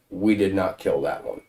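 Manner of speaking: low, blunt barbarian voice; a lightly voiced brute shrugging it off. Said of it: "In my barbarian tone".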